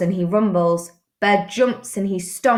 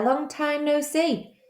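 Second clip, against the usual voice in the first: hare; 8 semitones higher, 4 dB quieter than usual. Acted. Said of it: "says Hare".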